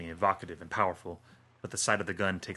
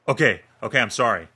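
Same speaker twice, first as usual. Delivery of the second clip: louder, higher anxious placating; nervous appeasing; 3 semitones higher, 10 dB louder than usual. Acted. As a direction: back off, hands up, begging. Dan backs away from the gun.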